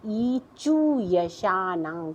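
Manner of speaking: each syllable drawn out slowly; slow, careful teacher chant; slow recitation. Shown as a demonstration. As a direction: recite the sutra slowly for pupils to copy. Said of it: "इसको बोलते समय भी आप ध्यान रखिएगा".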